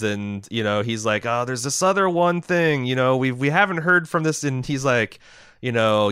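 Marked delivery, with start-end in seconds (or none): 1.3-4.4 lightly acted, higher-pitched film quote; a touch of feigned reluctance, pitched up; mock diplomat's report. Acting out film's diplomat scene.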